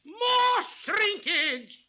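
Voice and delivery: high and slow, drawn out in alarm; slow dramatic cry. Acted. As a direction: stretch it out in horror. Vision grows worse.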